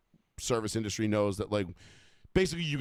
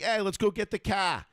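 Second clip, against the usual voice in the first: put-on higher-pitched Boston accent, R dropped on car; 7 semitones higher than usual. Catching oneself slipping into the accent, broad Boston. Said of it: "the rolling of the R's".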